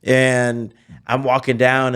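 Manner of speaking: ordinary delivery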